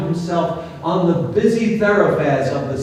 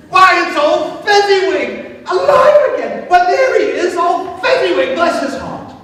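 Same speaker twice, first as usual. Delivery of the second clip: put-on higher, laughing excited old man; delighted exclaiming; 8 semitones higher than usual. A narrator's reading. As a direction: Scrooge overjoyed to see his old master alive. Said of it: "Scrooge exclaimed".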